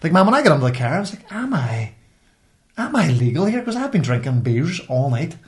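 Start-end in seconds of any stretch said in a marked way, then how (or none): none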